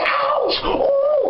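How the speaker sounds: high squealing mock ouches; fake pain cries. To her date, faking pain in bed, high squeals. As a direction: ham up the hurt to fool him. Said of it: "put on a whole Boy replay".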